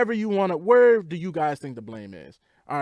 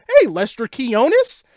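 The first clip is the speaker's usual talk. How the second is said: drawn-out high, loud mock hellos; slow sing-song mock greeting of each player; mock cheery greetings; 9 semitones higher, 8 dB louder, slower than usual. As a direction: call each name out like a gushing owner. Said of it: "I don't think she'd be like".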